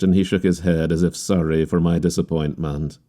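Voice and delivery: ordinary delivery, nothing marked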